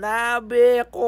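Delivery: slow, stretched sign-off "abi ako"; drawn-out catchphrase. Acted. Vlogger closing a segment for viewers, slow stretched catchphrase. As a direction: let "abi ako" linger slowly to close the bit.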